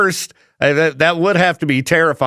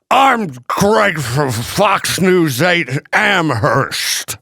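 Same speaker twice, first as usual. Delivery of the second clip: slow, drawn-out reporter voice; news guy voice; much slower than usual. Pretend. Reporter meeting someone at a party, slow news voice. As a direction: play the reporter introducing himself. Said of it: "talking like the guy from Fox News".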